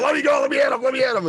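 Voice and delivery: high, urgent cry to be let go; straining against the men holding him; desperate plea. Retold or imitated. Held down at the retreat, begging to hit the man, high and urgent. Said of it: "And I said".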